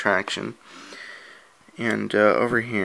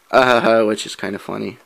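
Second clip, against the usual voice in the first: usual speaking voice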